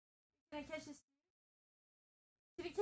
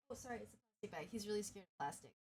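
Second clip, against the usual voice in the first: ordinary talk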